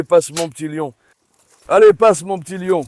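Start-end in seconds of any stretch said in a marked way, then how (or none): 1.7-2.8 louder, higher repeated command; urgent urging. Real, not acted. Owner urges his little lion to move on, urgent urging. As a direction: raise the voice to hurry a dog through.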